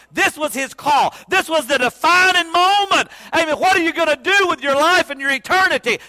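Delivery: loud, high, urgent preaching; fiery preacher raising his voice; preaching climax. Acted. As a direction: drive it home to the whole church.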